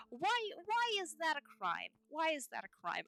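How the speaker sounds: exasperated plea to chat; indignant appeal. Real, not acted. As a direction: demand an answer. Protesting to chat.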